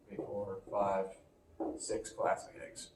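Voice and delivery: ordinary talk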